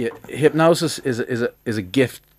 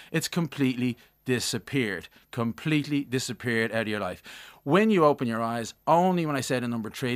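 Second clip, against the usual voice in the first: hypnotist voice; 4 dB quieter than usual. Real, not acted. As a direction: lull them under with each line. Hypnotic suggestion, slow.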